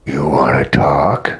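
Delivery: a posh orc lord asking coolly, low and unhurried; regal orc accent. Acted. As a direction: orc leader questions the stranger in a low, slow regal orc accent. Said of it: "more like a regal orc accent".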